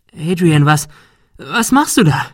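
high, loud, faltering question; horrified. A narrator's reading. Shirley to Adrian after he fired the rifle, horrified. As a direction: stumble into it, appalled. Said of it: "Shirley klang entsetzt".

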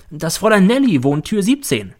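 interrupting. Acted. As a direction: cut in at once as the caretaker. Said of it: "Sie unterbricht mich sofort". (ins Wort fallend)

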